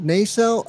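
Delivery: nacelle checked as NAY-sell; long first vowel. Real, not acted. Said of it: "I wanna be right in my pronunciation".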